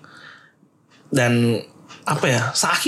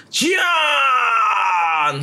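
announcer shout; 9 semitones higher than usual. Retold or imitated. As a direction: bellow the name like a ring announcer. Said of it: "kok announcernya".

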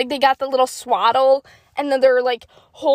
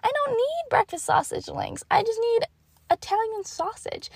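higher airy mom voice, a touch mocking; imitating mom; 9 semitones higher, 6 dB quieter than usual. Daughter imitating mom's casual correction on the phone. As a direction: play the mom brushing it off lightly. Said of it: "And she's like".

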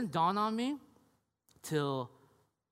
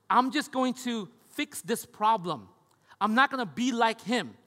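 stubborn promise to fix it; mock resolve; 5 semitones higher, 8 dB louder than usual. Voicing a resolved listener.

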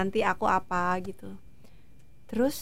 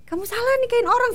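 high, louder mock protest; retold protest; 13 semitones higher, 4 dB louder than usual. Wife retelling her objection to covering up, high-pitched protest. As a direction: protest loudly, pitch jumping up.

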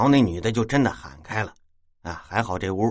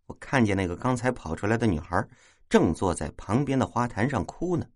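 ordinary delivery

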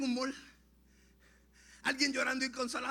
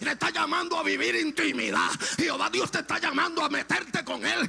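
full-force preaching; 3 semitones higher, 5 dB louder than usual. Acted. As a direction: cry it out to the congregation in a ringing voice.

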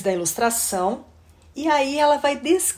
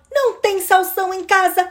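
put-on high, cheeky kid's retort; child talking back; 8 semitones higher, 4 dB louder than usual. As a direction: challenge mom like a stubborn child. Child argues back with mother.

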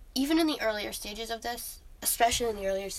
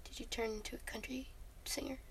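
whispering; 15 dB quieter than usual. Pretend. Joining the whispered bit.

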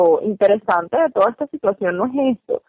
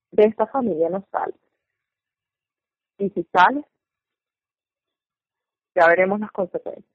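imitated Felipe; 4 dB quieter than usual. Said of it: "se lo dice en ese tono".

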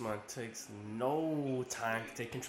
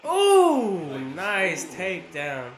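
loud high ooh, then drawn-out praise; slow, loud, impressed fight-fan call; excited cheer; 4 semitones higher, 14 dB louder, much slower than usual. Real, not acted. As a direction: burst out at a big move. Reacts to a takedown.

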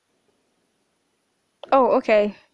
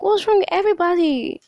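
much higher, louder exasperation; exasperated; 9 semitones higher, 5 dB louder than usual. Retold or imitated. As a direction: cry out, fed up with everyone. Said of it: "And I'm like".

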